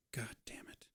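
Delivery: very soft whispered curse; muttered curse. Acted. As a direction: curse under your breath. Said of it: "And she's like".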